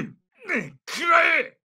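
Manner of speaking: high, forceful battle cry; attack shout. Acted. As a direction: shout it as you strike. Attack cry in combat voice lines. (攻撃の叫び)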